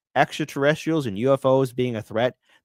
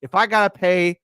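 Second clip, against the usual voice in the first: mock grumbling taxpayer; 7 semitones higher, 5 dB louder than usual. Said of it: "People be like".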